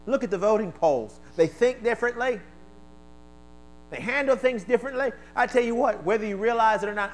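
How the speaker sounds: high, loud preacher's cry; full-force sermon delivery; fiery preaching. Real, not acted. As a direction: preach it hard to the room.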